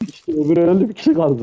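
excited. Real, not acted. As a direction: blurt it out, thrilled. Enemy killed, sudden excitement.

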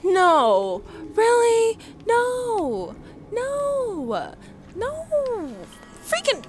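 rising high no after no; high wailing no; dismayed disbelief. Real, not acted. Caught after escaping alien.

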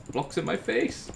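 normal talking voice

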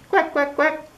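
high, far duck voice; high duck quacks. Acted. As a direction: quack 'Quack' brightly up high. Duck greets fox.

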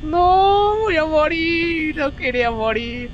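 high, loud, slow despairing wail; dramatic wail. Acted. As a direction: wail like you're doomed. Dying in the game.

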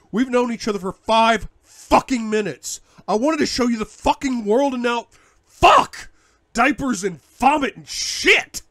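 loud, high furious rant, slowing at the end; raging rant. Acted. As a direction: rage at the whole situation. Continued bellowing rant.

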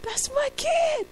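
singing father mock-sobbing over his son; mock bawling. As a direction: howl it like a weeping dad. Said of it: "bawling, going".

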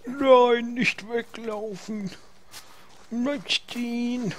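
high pleading call; calling after animal. Real, not acted. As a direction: beg it to stay put. Ruft einem Tier im Spiel nach. (hoch flehend rufen)

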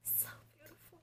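breathless whisper of awe; hushed admiring whisper; awed whisper. Real, not acted. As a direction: whisper it in wonder. Awed over photo.

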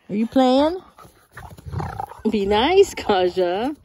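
loud call. Real, not acted. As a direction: call out over the dogs. Dogs playing rough.

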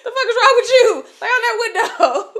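loud, high laughing shout; laughing yell. Retold or imitated. Woman cursing at a kid on her chest, laughing yell.

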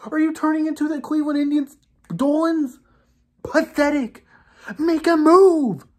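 raging rant. Real, not acted. Bruins fan ranting at Don Sweeney over re-signings, real rage. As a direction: let the fury build question by question. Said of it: "I'm really raging right now".

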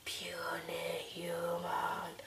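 slow soft menacing whisper; booming frost giant. Acted. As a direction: hiss it like a giant. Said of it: "I need a voice for that guy".

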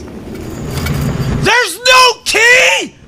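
very high, slow shriek; panicked shout. Real, not acted. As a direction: wail it in panic. Panicked search.

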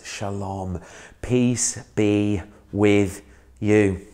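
Jesus greets his frightened disciples, softly; gentle blessing. Acted. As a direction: breathe the greeting out tenderly.

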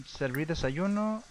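normal talking voice

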